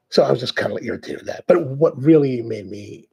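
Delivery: normal talking voice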